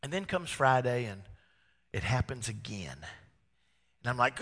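normal talking voice

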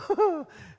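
high, loud laugh at himself; burst of laughter. Real, not acted. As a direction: laugh out loud at your own joke. Laughing at own gesture.

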